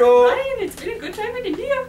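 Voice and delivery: loud, high hammed-up Indian accent; mock Indian accent. Guest answers host's tease, hammed-up Indian accent. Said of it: "I just love that accent".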